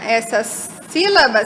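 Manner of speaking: normal talking voice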